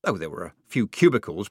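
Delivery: ordinary delivery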